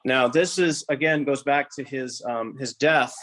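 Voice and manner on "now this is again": usual speaking voice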